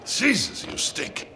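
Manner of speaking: disgusted. Acted. Smells the dirty prisoner.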